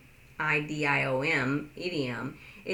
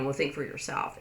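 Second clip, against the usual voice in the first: mild scolding; 3 semitones lower, 4 dB quieter, faster than usual. Retold or imitated. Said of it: "It's not that".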